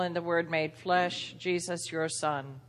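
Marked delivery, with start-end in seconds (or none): none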